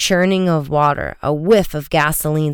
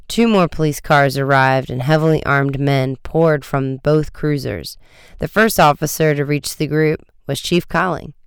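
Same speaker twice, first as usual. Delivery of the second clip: usual speaking voice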